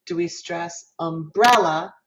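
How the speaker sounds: "umbrella" with BREL punched, slowly; stress on middle syllable of umbrella. Shown as a demonstration. Teacher offering the second option to the class, BREL stressed in "umbrella". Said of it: "which syllable in that word".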